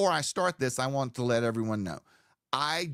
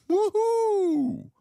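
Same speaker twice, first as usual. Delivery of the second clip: party whoop; 5 dB louder than usual. Retold or imitated. Party cheer.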